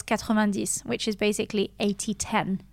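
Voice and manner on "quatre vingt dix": "quatre-vingt-dix" with French uvular r and nasal vowel; French pronunciation. Shown as a demonstration. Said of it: "The French way is".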